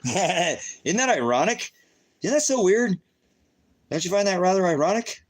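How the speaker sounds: mock sing-song. Mocking his viewers about where people live, in a high sing-song voice.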